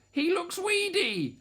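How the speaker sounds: high-pitched motherly grumble; grumbling. A narrator's reading. Mother T about baby number three, high grumbling. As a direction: complain in a high character voice. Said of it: "and she grumbled".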